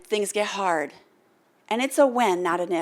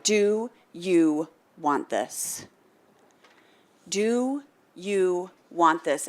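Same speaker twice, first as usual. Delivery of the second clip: slow, lightly lowered instructor voice; slow probing question; 2 semitones lower, much slower than usual. Retold or imitated. Instructor to the trainee, slow serious question. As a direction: ask it weighty and slow. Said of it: "He asked me".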